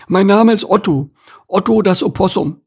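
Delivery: loud, high, sullen animal voice; grumpy opossum. Acted. Newcomer introduces himself to the beaver, grumpy.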